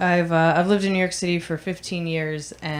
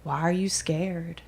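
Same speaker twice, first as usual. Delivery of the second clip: imitated slow, menacing whisper; creepy whisper; 2 semitones lower, 8 dB quieter, slower than usual. Guy who got in her face, imitated creepy whisper. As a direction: whisper it slowly, right in someone's face.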